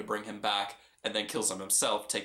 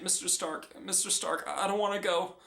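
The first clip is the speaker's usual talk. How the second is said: lightly voiced young hero, higher and pleading; Peter Parker pleading; 8 semitones higher than usual. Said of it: "Peter Parker saying".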